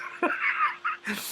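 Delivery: high suppressed giggle; soft squeaky laugh; laughter. Real, not acted. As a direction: giggle before the punchline. About to read punchline.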